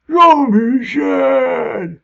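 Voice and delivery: high, loud, slow zombie wail. Pretend. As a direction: howl it like a heartbroken undead. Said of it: "Zombie voices".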